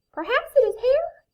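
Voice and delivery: very high, loud little mouse. A narrator's reading. Mouse guessing for Bear who hides, high mouse voice. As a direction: pipe up like a tiny creature.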